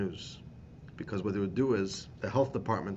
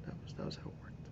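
hushed whisper; 15 dB quieter, faster than usual. Real, not acted. Rabbi telling listeners the grim truth, whispered. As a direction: drop to a breathless whisper. Grim aside after coffin line.

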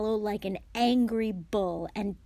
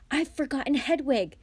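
high, loud burst of panic; alarmed cry; 7 semitones higher, 8 dB louder than usual. A narrator's reading. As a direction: blurt it out in sudden alarm. Harry panics as uncle wakes.

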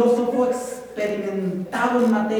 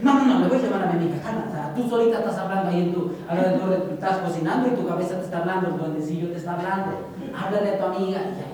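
fast, lower, rushing to reassure yourself; brisk, lower self-pep talk; hurried self-talk; 4 semitones lower, faster than usual. Acted. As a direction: talk yourself into it in a quick mutter-free rush. Self arguing back, rushing.

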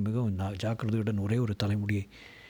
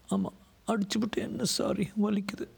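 high-pitched wounded reproach; hurt woman; 12 semitones higher than usual. A narrator's reading. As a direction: reproach him in a high female voice.

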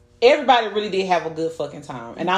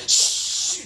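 a sharp whispered shush. Retold or imitated. Mama hushing the chatty guests, whispered shush. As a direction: hush the table like mama did. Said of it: "I just heard your mama".

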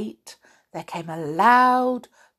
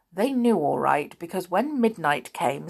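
ordinary delivery, nothing marked

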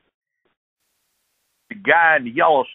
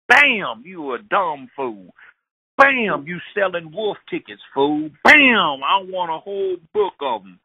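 gunshots and taunts; 5 semitones higher, slower than usual. Retold or imitated. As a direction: yell each Bam, sneer the insults. Man shooting while taunting.

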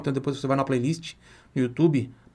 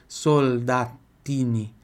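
very slow model; much slower than usual. Shown as a demonstration. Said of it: "slow model".

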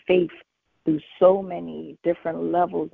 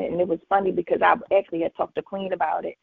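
usual speaking voice

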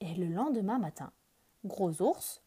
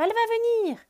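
higher repeat; 8 dB louder, faster than usual. Acted. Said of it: "dit Oiseau".

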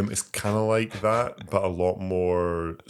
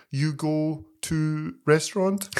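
high, very slow broken English; pidgin English tourist; 9 semitones higher, much slower than usual. Retold or imitated. A tourist asking a French local, slow mock pidgin. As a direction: spell it out slowly to a foreigner. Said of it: "we speak in pidgin English".

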